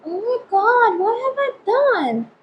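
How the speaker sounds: high, slow dismayed wail; mock horror at the mirror; drawn-out high oh my god. Real, not acted. Ruined makeup dismay.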